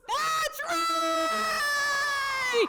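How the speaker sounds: high, full-throated cheer; rallying shout. Real, not acted. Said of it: "Say that again".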